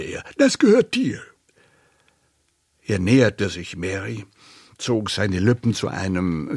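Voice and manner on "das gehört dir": put-on high, loud old man's voice; old uncle. A narrator's reading. Uncle Delbert hands Mary money, high old uncle. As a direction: pitch it well up, like an old uncle.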